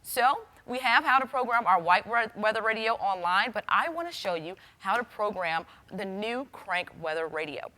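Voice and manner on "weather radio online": usual speaking voice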